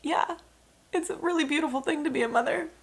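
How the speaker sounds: high, tearful voice; crying. Real, not acted. New mom telling the interviewer about motherhood, crying. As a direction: let the tears lift the voice high. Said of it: "I know I'm crying".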